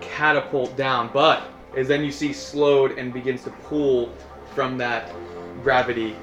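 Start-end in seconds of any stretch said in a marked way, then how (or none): none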